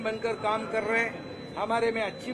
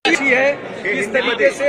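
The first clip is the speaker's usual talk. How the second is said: shouted question; 3 semitones higher, 9 dB louder than usual. Real, not acted. Shouted follow-up question in scrum.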